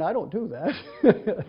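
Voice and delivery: slow, sheepish, chuckling through it; laughing confession. Real, not acted. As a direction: admit it with a guilty laugh.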